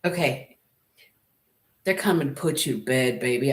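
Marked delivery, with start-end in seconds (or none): 1.8-3.5 low, seductive purr of a line; sultry low tease. Shown as a demonstration. Reader teasing a viewer about a new lover, in a low sultry voice. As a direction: drop down low and tease them with it. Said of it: "Can I say it like this?".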